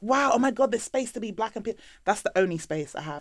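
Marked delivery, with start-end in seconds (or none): 0.0-1.7 loud, high impressed wow; impressed gasp. Retold or imitated. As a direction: gush like a dazzled onlooker. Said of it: "they're like".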